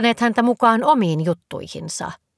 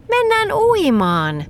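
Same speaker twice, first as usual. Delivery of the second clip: high, drawn-out happy cheer; joyful squeal; 12 semitones higher, 3 dB louder, slower than usual. A narrator's reading. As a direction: call it out gleefully, slow and soaring. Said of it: "Nelli hihkui iloisesti".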